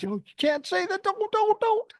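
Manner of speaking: high mock panicked wife voice; freaking out wife. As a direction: squeak it out in a panic. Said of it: "my wife to-be is just freaking out".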